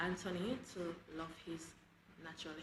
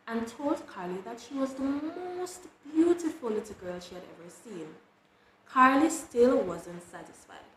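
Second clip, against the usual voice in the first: loud, lilting read-aloud with punched key words; big, animated storybook delivery; children's story narration; 9 dB louder than usual. A narrator's reading. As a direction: read expressively to a roomful of children.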